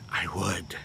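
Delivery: low, soft near-whisper; quiet confiding confirmation; hushed aside. Real, not acted. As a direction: drop it almost to a whisper.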